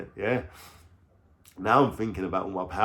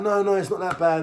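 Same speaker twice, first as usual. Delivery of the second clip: a hint of her higher voice; mock girlfriend voice; 7 semitones higher than usual. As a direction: lightly imitate her, pitched up. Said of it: "She's like".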